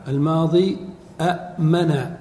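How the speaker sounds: ordinary talk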